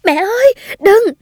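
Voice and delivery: high-pitched desperate cry to mother; screaming out. A narrator's reading. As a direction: cry out high, begging her to stop. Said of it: "tôi vẫn thét lên".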